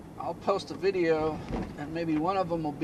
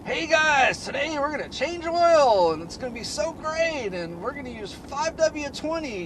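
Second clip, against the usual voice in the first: much higher and louder, hammed-up excitement; overexcited host voice; 11 semitones higher, 6 dB louder than usual. Imitating Chris Fix's thrilled oil change intro, hammed-up excitement. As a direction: gush like the happiest video host alive. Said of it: "getting his voice to sound like he's so excited".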